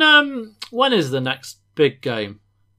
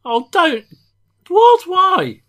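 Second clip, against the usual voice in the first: high, loud disbelieving what; shocked outcry; 8 dB louder, much slower than usual. Real, not acted. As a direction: yelp of outraged surprise. Co-host confesses hating his word.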